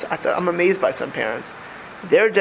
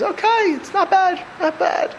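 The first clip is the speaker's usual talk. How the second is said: higher, slow, mock unimpressed shrug; a lukewarm "not bad", pitched up and slow; 12 semitones higher than usual. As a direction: play someone who's only half pleased. Mimics lukewarm Shabbos attitude.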